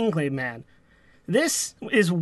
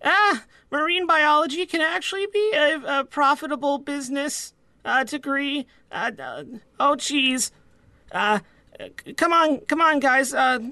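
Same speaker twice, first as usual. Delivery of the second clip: put-on higher-pitched, stammering young deckhand; hesitant son; 6 semitones higher than usual. First mate interrupting his dad to point something out, hesitant. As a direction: play an awkward son, voice pitched up and halting.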